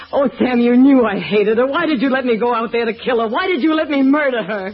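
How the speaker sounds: high, loud, desperate accusation; anguished outcry. Acted. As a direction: cry it out at him in anguish. Accusing Sam, rising to a cry.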